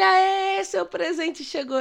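loud, high, drawn-out cheer; excited announcement. Retold or imitated. Shores reveals the driving-school gift, cheering loudly. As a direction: announce the big surprise, slowly.

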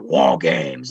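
deep, low announcer impression; William Regal announcement. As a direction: drop right down to mimic the big announcement. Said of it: "the Regal coming out on the microphone".

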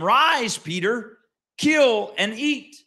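slow, high, loud heavenly command; drawn-out divine order to Peter; voice of the Lord. Acted. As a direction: call it down from heaven.

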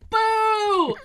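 loud, high, drawn-out boo; mock crowd heckle; heckling yell. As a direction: boo him from across the convention floor. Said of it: "just yell".

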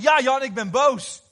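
slow, high, furious mock shout; fierce anger. Preacher imitates his friend admitting anger, fierce shout. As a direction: roar it out as the angry friend. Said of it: "heel fel".

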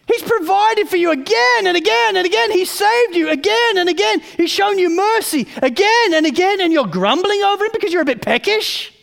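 high and loud, pressing each again; exasperated, insistent preaching; indignant rebuke. Real, not acted. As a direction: scold them for forgetting his mercy.

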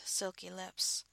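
usual speaking voice

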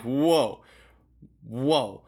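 mock taken-aback whoa, drawn out; retold whoa. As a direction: pull someone up short, retelling it. Acted reaction to being mislabeled.

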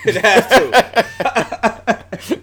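laughed out, slow and loud; laughing. Real, not acted. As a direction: laugh through the words. Laughing at own joke.

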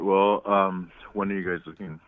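usual speaking voice

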